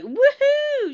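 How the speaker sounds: high, drawn-out party whoop; a hammed-up woohoo, slow and high; mock woohoo. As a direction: imitate her over-the-top entrance cheer. Mocking Melissa's loud entrance.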